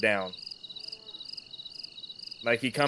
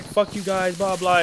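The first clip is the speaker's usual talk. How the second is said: higher, louder retold outburst, half-played; mock angry cussing; 7 semitones higher, 4 dB louder than usual. Narrator imitating the cursing captive, higher and louder mock anger. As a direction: toss off the insult like the angry player did.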